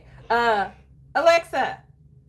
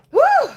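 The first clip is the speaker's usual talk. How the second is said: high, loud breathless woo; 13 semitones higher, 6 dB louder than usual. Real, not acted. Recovering from laughter.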